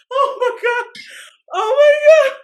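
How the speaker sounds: high squealed gasp of laughter; real high, laughing wail; crying with laughter. Real, not acted. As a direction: exclaim helplessly through tears of laughter. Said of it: "I'm crying".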